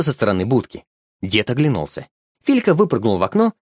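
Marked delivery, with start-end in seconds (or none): none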